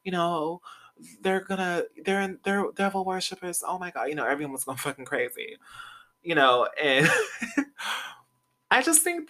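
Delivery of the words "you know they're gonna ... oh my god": imitating a panicked fan, higher throughout; mock-horrified gossip. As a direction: play the hysterical church crowd, half-joking. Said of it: "everyone was freaking out, like".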